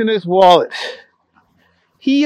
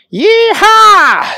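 high, loud whoop of joy; excited yee-haw; 7 dB louder than usual. Acted. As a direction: whoop like a cowboy. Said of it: "He was so excited".

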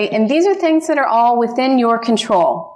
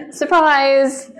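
delighted laughing reveal; laughing surprise; 3 semitones higher than usual. Real, not acted. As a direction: laugh it out, then spring the surprise. Surprise twist about meeting husband.

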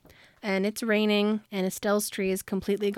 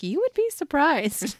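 higher, slow, drawn-out tease; teasing knowingness; 6 semitones higher, 4 dB louder, slower than usual. Retold or imitated. Luke answers Addie's disbelief about his deals, teasingly knowing. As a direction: stretch it out like a knowing boast.

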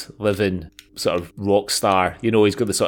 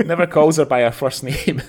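talking through laughter; higher voice breaking into a laugh; amused, laughing as the words come out; 6 semitones higher than usual. Real, not acted. Laughing over the Kettles joke.

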